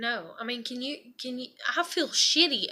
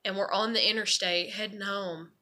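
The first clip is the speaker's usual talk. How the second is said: ordinary talk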